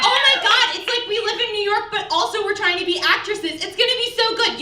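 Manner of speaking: high, gushing wannabe actress voice; mock actress gush. Comic mocking fellow comics' big plans, gushing high. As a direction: sing it up high like a giddy aspiring actress.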